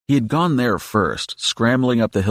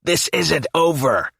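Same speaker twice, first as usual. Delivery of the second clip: slow, high imp voice; a bratty little demon warning; childish imp threat; 7 semitones higher, slower than usual. A narrator's reading. Fleeing imp warning Hellboy, slow and high. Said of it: "the childish imp voice yells".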